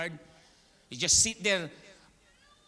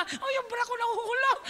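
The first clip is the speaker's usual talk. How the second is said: high, loud hammed-up screams with giggles; overacting drama queen; 12 semitones higher, 4 dB louder than usual. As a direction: camp it up like a diva drowning. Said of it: "nalulunod ka na nga". (OA na sigaw, parang nagpapaka-arte)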